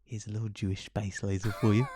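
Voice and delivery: hushed secretive whisper. Acted. Whispered mock gift line.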